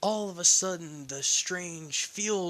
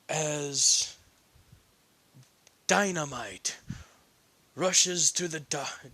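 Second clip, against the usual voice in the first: ordinary talk, nothing marked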